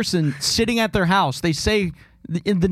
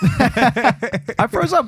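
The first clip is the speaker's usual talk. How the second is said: laughing through the words, drawn out slowly; 6 semitones higher, much slower than usual. Real, not acted. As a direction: laugh it off while admitting it. Laughing at own nervousness.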